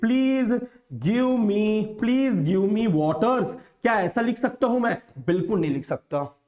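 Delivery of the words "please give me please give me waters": "Please give me waters" drawn out word by word, very slowly; very slow dictation. Shown as a demonstration. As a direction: spell it out slowly for the class. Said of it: "क्या मैं इसको ऐसा लिख सकता हूं कि". (बहुत धीरे बोलकर लिखवाना)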